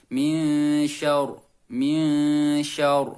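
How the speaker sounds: slow "min syarr" with a thick, heavy "syarr". Shown as a demonstration. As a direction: make the ro in "syarr" full and heavy. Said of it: "Jadi roknya harus tebal". ("syarr" dibaca dengan ro tebal)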